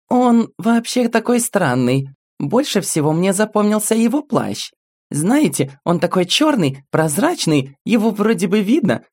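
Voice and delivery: put-on high, bright child voice; little girl voice. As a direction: speak as a small girl telling her story. Little girl character speaking.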